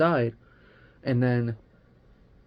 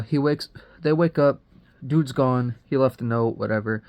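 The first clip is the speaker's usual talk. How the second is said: ordinary talk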